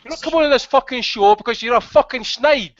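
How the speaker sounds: heated, pushy telling-off that speeds up then slows to spit the insults; angry rant. Real, not acted. Host laying into caller Dab Dab on air, angry rant. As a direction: tear into him for being a snitch. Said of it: "[name]'s rant".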